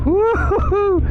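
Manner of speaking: high excited whooping cry. Real, not acted. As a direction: whoop at the sudden downpour. Caught in sudden heavy rain.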